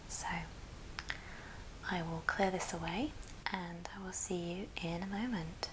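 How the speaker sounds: ordinary talk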